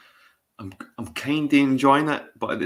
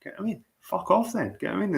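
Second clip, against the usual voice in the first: high, incredulous retort; exasperated disbelief; 9 semitones higher, 4 dB quieter than usual. Retold or imitated. Retelling his reply to a moaning coworker, exasperated. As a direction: squeal it out, fed up and baffled. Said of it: "And I was like".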